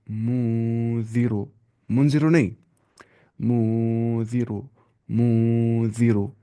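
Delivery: drawn-out chant with a nasal hidden nun; slow Quran recitation. Shown as a demonstration. Teacher recites the verse slowly for students. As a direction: recite slowly, stretching each vowel. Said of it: "नाक में आवाज़ छुपानी है".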